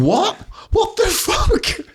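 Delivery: very high, drawn-out mock disbelief; incredulous shriek. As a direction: squeal it in total disbelief. Incredulous reaction to friend.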